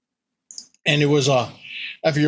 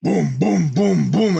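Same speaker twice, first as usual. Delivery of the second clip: booming footsteps; 4 semitones higher, slower than usual. Retold or imitated. Imitating monster footsteps.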